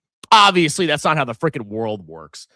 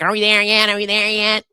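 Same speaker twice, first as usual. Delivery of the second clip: imitating a whining daughter; 5 semitones higher than usual. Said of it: "my daughter's usually whining in the back".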